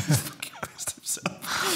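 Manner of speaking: whispered slowly in disbelief; whispered aside to his friend, slowly. Real, not acted. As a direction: drop to a hushed whisper for the punchline. Punchline of dad wetting himself.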